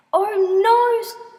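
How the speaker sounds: high, loud drawn-out cry; loud acted scream; screamed dismay. A narrator's reading. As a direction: wail it out in horror. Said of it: "she screamed".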